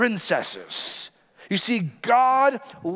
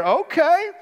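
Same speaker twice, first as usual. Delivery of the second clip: much higher, louder "Okay" with a rise; mock-giddy mother-in-law with an idea; playful excited realization; excited matchmaker; 10 semitones higher, 4 dB louder than usual. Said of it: "You can see Naomi's mind. She's churning here".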